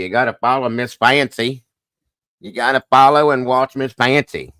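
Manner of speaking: bad Southern accent. Streamer plugging Miss Fancy's channel, bad Southern accent. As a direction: stretch "Fancy" in a mock Southern twang. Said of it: "I have the worst Southern accent".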